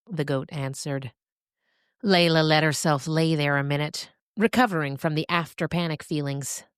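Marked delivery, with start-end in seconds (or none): none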